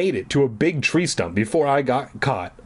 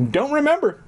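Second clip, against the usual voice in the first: ordinary delivery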